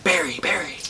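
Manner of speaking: soft, small distant call; little voice. Retold or imitated. A little voice calling to Barry, soft and small. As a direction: call out softly, as a tiny voice. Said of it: "there's this little voice".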